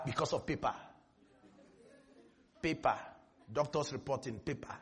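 very low, barely audible voice; low murmur over something so small; hushed incredulity. Real, not acted. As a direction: drop right down, low and hushed. Mocking despair over a doctor's paper.

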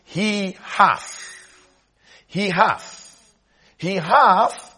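He hath, He hath, He hath, each one hammered; preacher pounding He hath; emphatic repetition. Shown as a demonstration. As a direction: drive home hath three times, rising. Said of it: "the reason I'm emphasizing this".

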